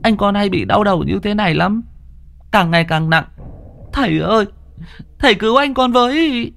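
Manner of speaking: put-on high tearful boy's plea; whimpering boy. Little brother begs the master to save Đang, high tearful plea. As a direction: plead with the master near tears. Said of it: "nó mếu máo".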